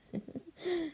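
a quick teasing giggle; little laugh at her blushing girl; soft giggle. Acted.

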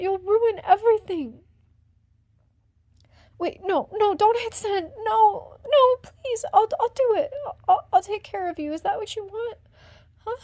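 rising panic, near screaming; panicked begging. Acted. Woman pleading with her partner not to send the video, panicked and loud.

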